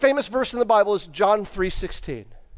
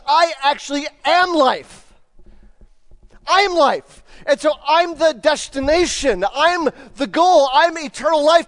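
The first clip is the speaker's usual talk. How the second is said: emphatic Jesus; 5 semitones higher, 4 dB louder than usual. Acted. Preacher voicing Jesus emphatically.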